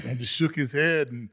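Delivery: ordinary talk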